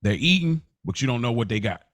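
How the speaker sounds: normal talking voice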